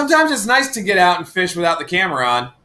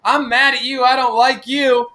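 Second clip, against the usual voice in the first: mild mock yell, higher and drawn out; mock scolding; 6 semitones higher, slower than usual. Retelling her yelling at John, mock scolding. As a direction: slowly voice her telling him off, pitched up. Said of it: "she was yelling at John".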